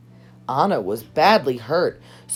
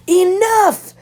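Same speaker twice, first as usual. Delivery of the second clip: a sharp, much higher, much louder cry; furious shout; 8 dB louder than usual. Acted. Elsa losing control in front of everyone, furious shout. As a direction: snap it out as the magic bursts free. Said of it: "she shouted".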